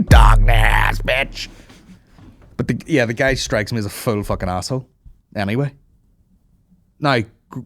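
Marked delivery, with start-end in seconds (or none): 0.0-1.5 dramatic growl. Acted. Loud dramatic name call.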